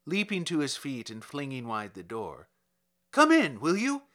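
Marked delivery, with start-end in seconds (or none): 3.1-4.1 high, loud eager invitation; eager welcome. A narrator's reading. Kovalev eagerly calling the officer inside. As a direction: call them in at once.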